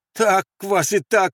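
high, loud, slow curse; exasperated shout. Acted. Andres cursing the sentries who doubt him, shouting. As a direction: shout it up, drawn out. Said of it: "крикнул Андрес".

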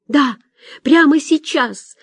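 high, urgent exclamation; crying out. A narrator's reading. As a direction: cry out a plea to come at once. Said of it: "выкрикнула Соня". (выкрикивая, настойчиво)

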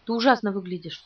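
ordinary delivery, nothing marked

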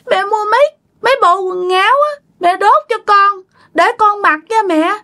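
put-on high-pitched pleading child spirit; possessed child voice. Dead child asking mother for burnt clothes, child voice. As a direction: beg mother like a cold little child. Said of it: "Cái lúc này nó mới nói".

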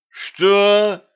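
high, outraged cry. A narrator's reading. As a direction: voice leaping up in disbelief. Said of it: "Повысила голос королева".

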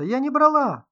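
very high, loud protest; screamed denial. A narrator's reading. Writer insists she took nothing, screaming. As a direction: cry out in frantic denial. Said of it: "закричала писательница".

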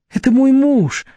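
high-pitched, eager blurting; hasty interjection. A narrator's reading. Vera jumping in to explain her husband, high and hasty. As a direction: jump in, pitched well up. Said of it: "тут же выскочила Вера".

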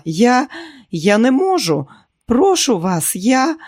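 high, pressing plea; frightened pleading. A narrator's reading. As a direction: beg in panic, voice pitched right up. Terrified woman pleading with attacker.

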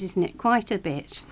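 ordinary delivery

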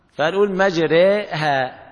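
very slow مجريها, alif leaned to e; مجريها بالإمالة ببطء; imala demo. Shown as a demonstration. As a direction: draw out مجريها with full imala. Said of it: "بنفس الطريقة".

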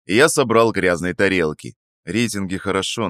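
usual speaking voice

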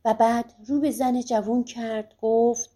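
ordinary delivery

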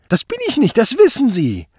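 very high, loud panic; shrieked denial. A narrator's reading. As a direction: screech your innocence at your accuser. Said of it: "kreischte Kluge". (panisch gekreischt)

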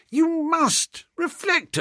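very high-pitched insistence; desperate pleading. A narrator's reading. Narrator arguing with refusing pal, desperate pleading. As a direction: implore him at the top of your pitch. Begging his pal to stay.

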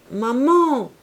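loud, high call of Maman; sudden call. Acted. Clorinde abruptly calling out to her mother. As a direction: call out abruptly across the room. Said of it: "cria brusquement Clorinde".

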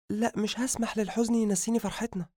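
high wife voice, quietly resolute; determined. A narrator's reading. Wife wiping away her tears, quiet high-voiced determination. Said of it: "مسحت دموعها بإصرار ونهضت قائلة".

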